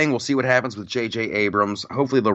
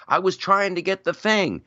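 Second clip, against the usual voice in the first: lightly acted, higher-pitched excuse; Harrison Ford; 10 semitones higher than usual. Actor answering his co-star on set, mild protest. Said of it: "Harrison Ford is like".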